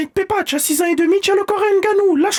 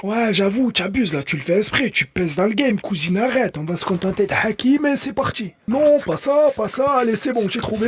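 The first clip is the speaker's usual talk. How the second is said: much lower gruff father; deep dad voice; 8 semitones lower than usual. Acted. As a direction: grumble like a tired old dad. Le père parle en voix grave. (voix de père bien plus grave)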